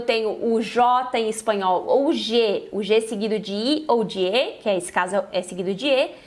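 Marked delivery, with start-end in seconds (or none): none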